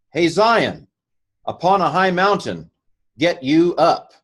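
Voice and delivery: lifted, ringing verse reading; declaimed. Shown as a demonstration. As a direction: call out to Zion on the mountain.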